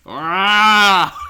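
loud, high open-mouthed scream; long mock scream of shock; Macaulay Culkin. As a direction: yell "Ah" as a comic movie scream. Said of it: "you doing Macaulay Culkin Home, Alone face".

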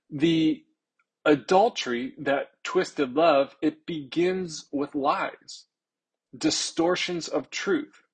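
slow, drawn-out sermon cadence; impassioned preaching. Real, not acted. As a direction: lean into every word, slowly.

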